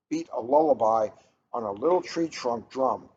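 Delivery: ordinary delivery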